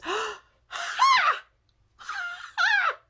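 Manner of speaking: very high, separate bursts of ha; squeaky acted laugh breaking out in single yelps; startled laughing. A narrator's reading. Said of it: "she starts laughing".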